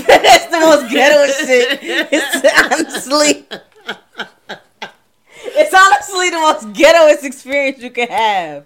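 laughing loud and high through the words. Real, not acted. Warning her friend off the app, laughing loudly. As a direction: crack up while warning them off.

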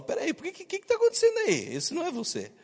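God baffled at a fake voice; God confused by a put-on prayer, high; puzzled God. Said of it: "Deus fala".

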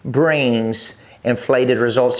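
very slow, every word drawn out; slow call to repeat. Shown as a demonstration. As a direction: give "Inflated faith brings inflated results" slowly so the congregation can say it along. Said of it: "I want you to say it with me".

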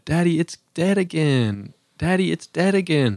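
put-on high, whiny child's complaint; whiny kid voice. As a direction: whine it like a small daughter. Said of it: "she came in here and she said".

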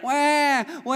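high, loud mock baby wail; baby crying wah. Pastor imitating a crying newborn, mock wail. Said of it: "it's like".

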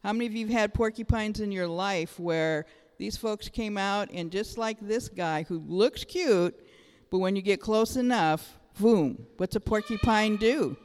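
usual speaking voice

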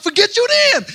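high, loud exasperated yell; hammed-up panicked dismissal, shouted; frantic brush-off. Retold brush-off while searching.

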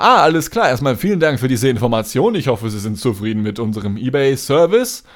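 loud, overly friendly call-center agent; customer service voice. Retold or imitated. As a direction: gush politely like a phone agent. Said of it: "so übertrieben freundlich".

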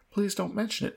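understated, high-pitched exclamation; mild cry. A narrator's reading. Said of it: "cried the Earl".

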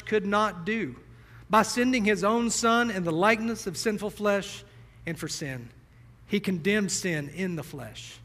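clear, measured scripture reading from the pulpit; preacher reading Romans to the congregation, earnest. Acted. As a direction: read the passage with steady conviction. Reads scripture aloud.

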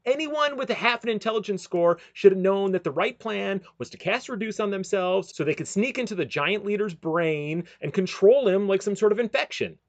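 ordinary talk

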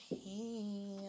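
breathy flirty hey; sexy voice. Retold or imitated. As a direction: fake seductive hey. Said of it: "when they try to be sexy".

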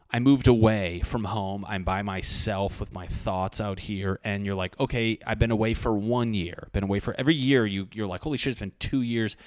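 ordinary talk, nothing marked

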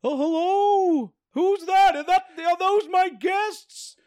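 put-on high, slow, eager old man voice; drawn-out creepy welcome, high and slow; high old-timey shopkeeper. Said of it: "my new character... remember the voice".

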